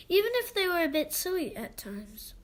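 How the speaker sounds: usual speaking voice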